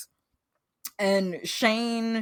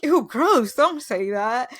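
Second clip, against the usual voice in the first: higher, louder mock "ew, gross"; mock disgusted cringe; 5 semitones higher, 6 dB louder than usual. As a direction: recoil like an embarrassed boyfriend. [name] embarrassed at word lovers.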